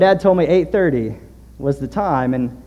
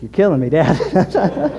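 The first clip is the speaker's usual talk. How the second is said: laughing exasperation; 4 semitones higher, 3 dB louder, slower than usual. Retold or imitated. Teasing dad over missing details.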